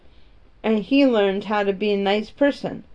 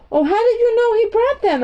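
put-on much higher, startled girl asking; Mary caught off guard, high and eager; surprised; 13 semitones higher, 4 dB louder than usual. A narrator's reading.

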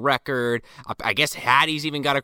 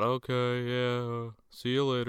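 softer, offhand mumbled goodbye; casual; 6 dB quieter than usual. Retold or imitated. Partygoers strolling out past the cops, imitated casual goodbye. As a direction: toss it off quietly, unbothered. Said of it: "People just casually walk out".

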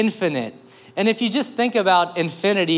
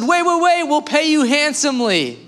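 high, loud, urgent call after him; pleading zookeeper; 10 semitones higher, 3 dB louder than usual. Retold or imitated. Zookeeper calling after the leaving man, urgent and high. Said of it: "And the zookeeper said".

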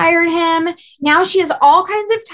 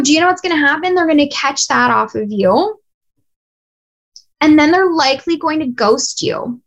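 ordinary talk

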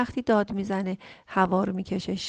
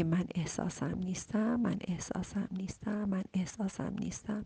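soft, then whispered mantra; fading to whisper; 7 dB quieter than usual. Shown as a demonstration. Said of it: "بگی". (تکرار آرام مثل ورد تا نجوا)

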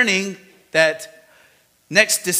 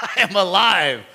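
triumphant I am alive; 2 semitones higher than usual. Acted. Jesus speaking to fallen John.

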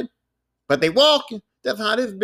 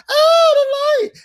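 high, loud mock shriek of pain; monster's shriek; 7 dB louder, much slower than usual. The vampire daughter hit by sunlight, high shrieking cry. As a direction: shriek like a monster burned by light. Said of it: "this bitch goes".

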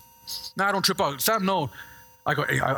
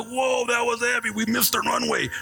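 alarmed whoa; 3 semitones higher than usual. Retold or imitated. Plane misses runway.

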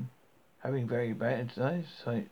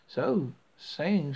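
usual speaking voice

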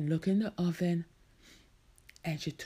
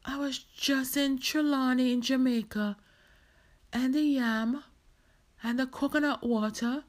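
high, raised-voice insistent listing; disbelieving; 6 semitones higher, 5 dB louder than usual. Acted. Marcia recalling her trip to Jamaica, disbelieving. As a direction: list each memory as proof, incredulous.

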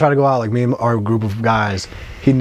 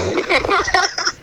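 shrieking burst of laughter; high laugh. Real, not acted. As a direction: squeal with laughter. Laughs at friend's reply.

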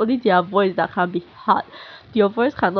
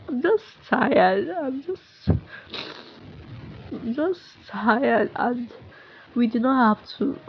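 real emotion; slower than usual. Real, not acted. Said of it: "I don't know why I'm so emotional now".

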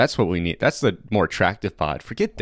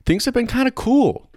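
high-pitched; 4 dB louder than usual. Pretend. High goofy emphasis on kinda.

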